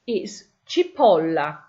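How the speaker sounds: ci-POL-la, stress on the O. Shown as a demonstration. Teacher modelling "cipolla" for learners, stress on POL. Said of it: "That's where your stress is on the word".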